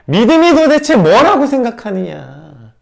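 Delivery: loud slow stern question; rebuke. Acted. Jesus challenging the disciples, loud slow rebuke. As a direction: press the question slowly and loud. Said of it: "지금 이렇게 말하고 있는 거".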